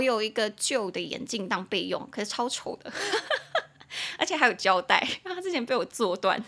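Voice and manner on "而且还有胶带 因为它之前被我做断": talking through giggles; laughing. Real, not acted. Host admitting to broken taped backup glasses, laughing. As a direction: confess it while cracking up.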